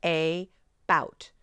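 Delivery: "A bout" as two separate words, AY... BOUT; spelling pronunciation "A bout", both syllables stressed; exaggerated long A. Shown as a demonstration. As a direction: say a full letter-name A in "A bout", then pause. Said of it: "think it as an A".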